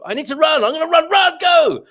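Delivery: put-on high, loud burst of childlike urgency; excited child's shout. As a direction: act out a kid bursting to run. Acting out a child's running.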